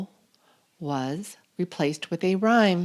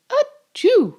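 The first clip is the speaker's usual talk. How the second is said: high, loud acted sneeze; mock sneeze; 6 dB louder than usual. The mighty sneeze, acted high and loud. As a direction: let out a big fake sneeze.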